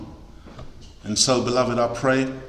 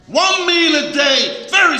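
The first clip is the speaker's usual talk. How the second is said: shout; 13 semitones higher, 4 dB louder than usual. Real, not acted. Preaching the fasting rule.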